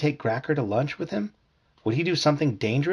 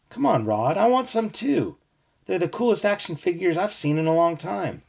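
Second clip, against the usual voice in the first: put-on whiny kid begging; 7 semitones higher than usual. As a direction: beg like a kid who wants a toy. Mickey begging for the figures.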